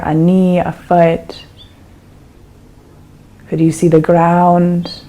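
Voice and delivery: calm, unhurried meditation guide with long pauses; guided meditation. Acted. Teacher guiding a listener through an eyes-open meditation, calm and unhurried.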